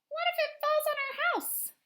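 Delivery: high, small voice fretting over the tree; a child's anxious what-if; worried little girl. Acted. Wimberly voicing a worried question.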